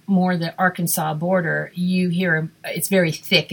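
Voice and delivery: ordinary talk, nothing marked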